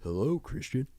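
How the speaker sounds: hushed, gentle voice that spares the vocal cords; imitating a careful, soft-spoken singer; soft opera singer's speaking voice. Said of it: "he normally spoke like".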